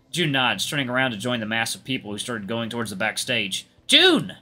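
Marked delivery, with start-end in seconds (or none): 3.9-4.4 high, loud call. A narrator's reading. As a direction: call after someone walking away. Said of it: "I called out to him".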